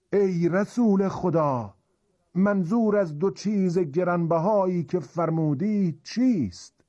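man calling out from a crowd; high, projected question, calling to a revered leader; loud voice. Acted. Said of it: "با صدای بلند گفت". (پرسش با صدای بلند از میان جمعیت)

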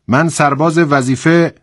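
high, ringing soldier's report; loud, clear voice. A narrator's reading. Soldier answers the commander, loud and clear. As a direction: announce it loudly, like a recruit reporting. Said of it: "با صدای رسایی گفت".

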